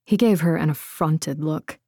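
plain reading voice

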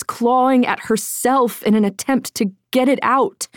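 ordinary delivery

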